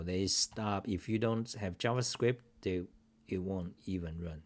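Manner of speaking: ordinary delivery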